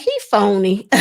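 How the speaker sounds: laughing while calling him fake. Real, not acted. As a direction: chuckle through the words.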